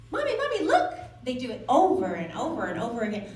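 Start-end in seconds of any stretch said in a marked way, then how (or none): 0.1-0.9 put-on high-pitched kid calling out to mommy; excited child. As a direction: beg for mom's eyes in a high little voice. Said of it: "they'll be on the swing".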